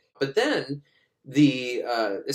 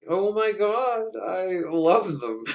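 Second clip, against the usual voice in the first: mock swoon; 6 semitones higher, slower than usual. Guy ogling, excited reply.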